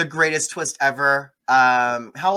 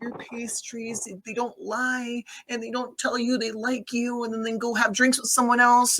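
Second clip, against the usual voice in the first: whiny tearful rant; 9 semitones higher than usual. Retold or imitated. Lena's tearful monologue to grandma.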